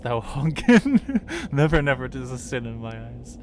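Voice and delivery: breaking into loud laughter mid-line; can't keep a straight face through the line; laughing. Real, not acted. Voicing the tree but cracking up laughing.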